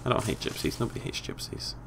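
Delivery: very soft aside, fading to whisper; hushed correction trailing into a whisper; muttered retraction. Real, not acted. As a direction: take it back under your breath. Quick retraction of rant.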